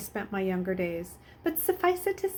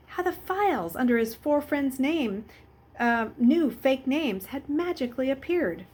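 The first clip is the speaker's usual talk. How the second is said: plain reading voice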